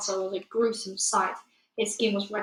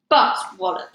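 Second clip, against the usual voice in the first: much louder booming brute voice; booming giant; 9 dB louder than usual. Acted. The Bloodbottler accusing the BFG, loud booming voice. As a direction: boom it out accusingly. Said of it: "he boomed".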